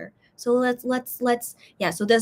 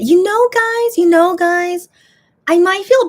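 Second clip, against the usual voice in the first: loud call out; 9 semitones higher, 10 dB louder than usual. Real, not acted. Calling out to viewers.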